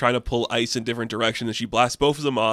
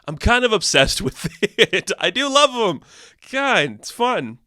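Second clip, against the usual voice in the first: high, louder, giggly gushing; laughing; 9 semitones higher, 4 dB louder than usual. Real, not acted. Vlogger admits loving the toxic pairing, laughing. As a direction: laugh through the confession.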